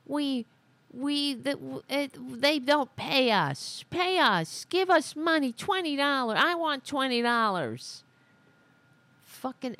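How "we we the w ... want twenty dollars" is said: high whiny mock voice; higher, childish mocking impression; mocking Greene. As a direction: sneer it like a greedy whiner. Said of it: "She's like".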